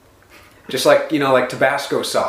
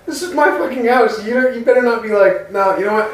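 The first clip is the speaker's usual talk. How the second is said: higher-pitched, indignant protest; 7 semitones higher than usual. Real, not acted. Host fuming after his co-host walks out, indignant. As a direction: protest in a raised, heated pitch.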